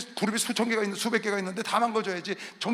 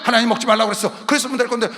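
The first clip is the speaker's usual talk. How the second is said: loud, exasperated sermon retelling; full-force preaching; 5 semitones higher, 13 dB louder than usual. Real, not acted. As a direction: open on a shout, keep scolding loudly. (목청 높여 꾸짖는 설교)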